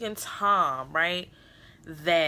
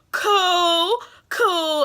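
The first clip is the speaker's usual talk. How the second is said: high, pained sarcastic cool; hurt, sarcastic cool cool; bitter cool; 9 semitones higher, 5 dB louder than usual. Real, not acted. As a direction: say it like it stings.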